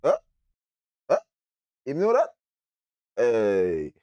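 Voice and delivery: short, rising huhs with gaps between; retold puzzled reaction. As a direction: act out a baffled double-take. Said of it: "I mean, I say".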